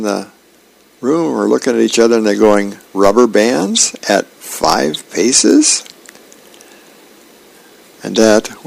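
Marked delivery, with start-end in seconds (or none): 2.9-5.8 slow, drawn-out question; puzzled question. A narrator's reading. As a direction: draw it out with a rising end. Officers puzzled by the duel terms.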